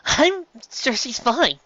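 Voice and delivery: high, slow, forced reassurance; reassure, not quite believing; forced confidence. A narrator's reading. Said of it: "with far more confidence".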